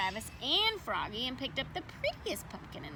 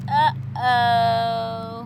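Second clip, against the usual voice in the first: loud, dismayed uh-oh; 10 dB louder than usual. A narrator's reading. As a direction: say it like a sudden little disaster. Pumpkin smashed.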